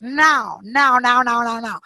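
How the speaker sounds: "now" said as Portuguese "não". Shown as a demonstration. Showing the group how to refuse, Portuguese "now" for no. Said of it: "In Portuguese".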